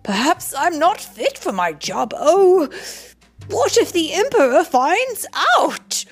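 rising to a high, dramatic fret; worried thought. A narrator's reading. Prime minister fretting he'll be found out, worried. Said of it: "he thought".

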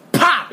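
quick imitated popping noise; imitated pop. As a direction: pop it out like a bursting transformer. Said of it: "I hear, well, like".

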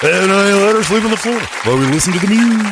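loud, high goofy man's voice; Chuck voice. Acted. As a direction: bellow it like a proud dope. Comic switch to Chuck's voice.

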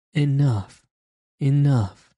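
narrated robber crying out for mercy. A narrator's reading. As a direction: cry out as the beaten robber. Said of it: "cried the robber".

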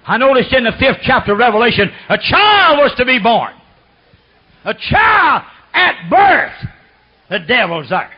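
high, loud and slow; preaching at full force. Acted. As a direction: hammer each phrase home. Preaching climax.

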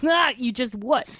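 slow, loud and pointed; slow confrontational challenge. Retold or imitated. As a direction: dare him to finish, slowly. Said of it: "I lost my mind on that guy".

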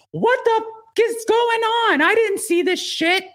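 high, far shocked voice, slow at the end; shocked Kelly. Retold or imitated. Kelly on the phone, shocked at the news. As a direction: play Kelly gasping in disbelief. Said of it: "Kelly's like".

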